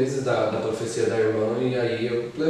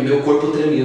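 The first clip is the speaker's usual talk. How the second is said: normal talking voice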